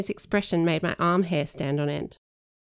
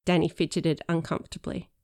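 plain reading voice

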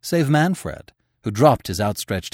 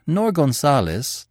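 plain reading voice

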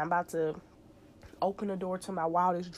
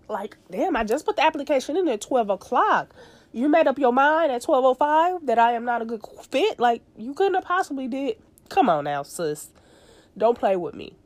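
exasperated rant; 6 semitones higher, 6 dB louder than usual. Acted. Venting at hiring person.

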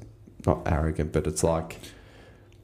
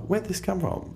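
shocked question; 13 semitones higher than usual. Retold or imitated. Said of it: "I was like".

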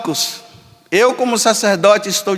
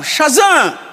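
calling Shazam; 7 semitones higher than usual. Retold or imitated. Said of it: "esfregava e gritava".

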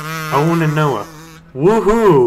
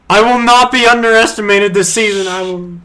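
dramatic declaration; 7 semitones higher, 5 dB louder than usual. Acted. Reading character line dramatically.

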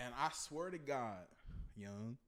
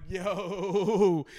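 loud, gleeful shout to a buddy; hammed-up excited callout; mock amazed outburst; 4 semitones higher, 11 dB louder, slower than usual. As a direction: holler the discovery, rising into a laugh.

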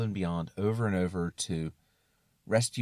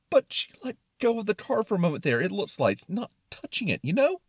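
high, fast worried quibbling; concerned Brain; 11 semitones higher, 5 dB louder, faster than usual. Acted. Brain quibbling about touching the car, concerned and high. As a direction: rush out the objection, rising at the end. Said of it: "in a very concerned tone".

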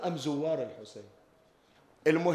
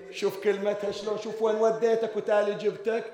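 ordinary talk

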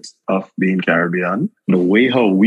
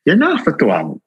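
much higher, gentle scolding auntie; Miss Morrison's voice; 10 semitones higher than usual. Retold or imitated. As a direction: coax the returning dear back home. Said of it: "she was like".

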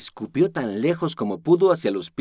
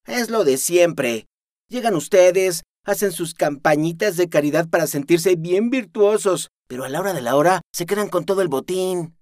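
bitter accusation; 8 semitones higher, 5 dB louder than usual. Acted. Aris accusing the outsiders, bitter and loud. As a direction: lay the blame on them with contempt.

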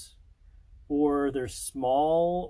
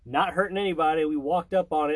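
ordinary talk

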